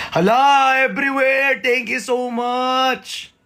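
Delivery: high, drawn-out gushing thanks; excited squeal. Real, not acted. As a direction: squeal your thanks, stretching the last words. Squeals thanks to gift sender.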